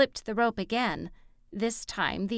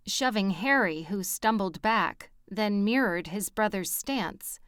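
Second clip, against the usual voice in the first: usual speaking voice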